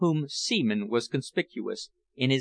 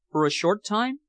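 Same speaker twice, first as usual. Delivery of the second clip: higher, pleading ask; 8 semitones higher than usual. A narrator's reading. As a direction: coax him to stay a little. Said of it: "she pleaded".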